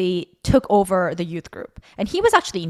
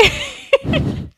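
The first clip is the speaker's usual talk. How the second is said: high laugh. Real, not acted. Laughing about demonic seventh grade.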